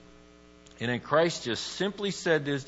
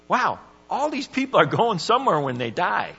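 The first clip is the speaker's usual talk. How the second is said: sudden awed realization, raised high and loud; amazed wow; 7 semitones higher, 7 dB louder than usual. Retold or imitated. Imagined churchgoer amazed at the crowd. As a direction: gasp it like it just hit you.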